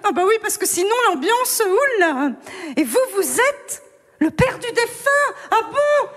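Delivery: put-on high giggly drunk guest chattering on; high, laughing, clueless party voice; tipsy Catherine. Drunk Catherine at the wrong party, high and giggling. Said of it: "Je me mets en mode Catherine Fro".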